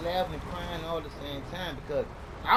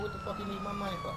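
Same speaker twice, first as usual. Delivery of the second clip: squeaky mock voice; 4 dB quieter, faster than usual. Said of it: "I'd be like".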